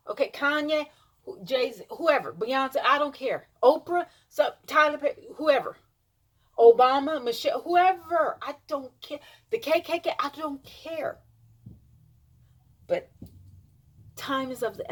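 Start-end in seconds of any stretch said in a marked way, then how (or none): none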